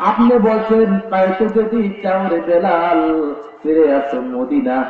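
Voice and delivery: high, chanted lament to the Prophet; tearful pleading. Acted. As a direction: melodic wailing appeal. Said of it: "কাঁদে আর বলে".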